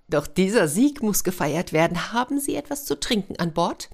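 high, lightly affected lady flower; an elegant, slightly haughty lady; Fräulein Orchidee. Acted.

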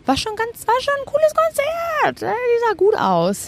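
very high, squeaky gushing voice; mock gushing. Gushing about the [name] concert in a squeaky high voice. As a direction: squeal it like a starstruck fan.

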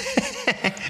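spöttisches, hauchiges Lachen; breathy laugh. Real, not acted. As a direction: lach ungläubig auf. Mocks the magazine claim. (ungläubiges Lachen)

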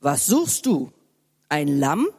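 low grumbling stranger; deep man's voice. Acted. Stranger questions the lost boy, low grumble. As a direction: ask in a deep voice. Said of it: "brummte eine tiefe Männerstimme".

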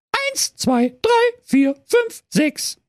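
very high piping count; little goats counting off; kids counting. A narrator's reading.

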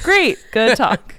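slow, dry sarcastic aside. Retold or imitated. Slow sarcastic retort, retelling the talk with her dad. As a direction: drawl it out sarcastically. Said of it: "And I was like".

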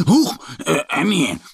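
high startled huh; startled cry. Acted. Mo startled by Annie, high startled cry. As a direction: jump with fright. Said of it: "ruft Mo".

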